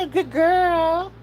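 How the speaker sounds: put-on high, slow baby voice; voicing the dog. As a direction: stretch it out high like a puppy.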